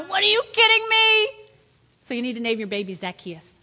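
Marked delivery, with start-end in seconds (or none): 0.0-1.3 much higher, incredulous; can't believe it; stunned disbelief. Acted. Zacchaeus hearing Jesus will visit, high incredulous. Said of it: "Everybody around is going".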